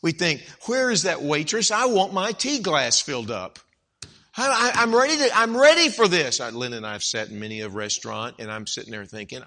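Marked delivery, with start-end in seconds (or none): 0.7-6.4 mock indignant customer, getting louder; hammed-up entitled diner; entitled impatience. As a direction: demand service with building impatience. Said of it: "an entitlement mentality".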